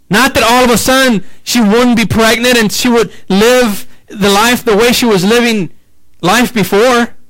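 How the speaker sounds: rising, fervent preaching climb; preaching at full force. Acted. Preacher building passion for his congregation, high and fervent.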